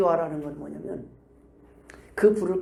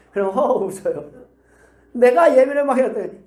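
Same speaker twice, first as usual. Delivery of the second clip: usual speaking voice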